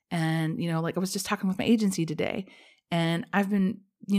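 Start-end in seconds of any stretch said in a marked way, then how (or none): none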